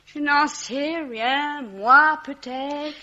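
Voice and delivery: slow, drawn-out, tipsy; dazed drawl. Acted. Drunk woman slowly musing that maybe she saw him last. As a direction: drag each word out wearily.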